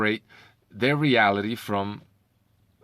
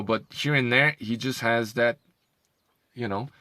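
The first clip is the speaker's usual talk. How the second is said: normal talking voice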